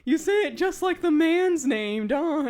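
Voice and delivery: lightly acted mocking disbelief; retold teasing. Retelling Australian mocking her pronunciation.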